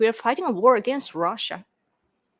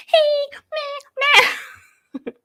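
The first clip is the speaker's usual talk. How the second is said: put-on much higher, squealed cute girl voice; mock cute Japanese girl; 5 dB louder, much slower than usual. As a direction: play the giggly girl in a comedian's bit. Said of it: "he would say".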